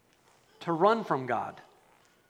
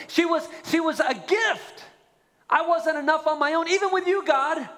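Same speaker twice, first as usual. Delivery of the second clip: impassioned plea; 7 semitones higher than usual. Retold or imitated. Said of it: "then Adam go".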